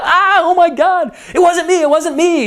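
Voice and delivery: very high-pitched mock panic; hammed-up terrified denial, voice shooting up; Macbeth freaking out. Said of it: "Macbeth starts freaking out".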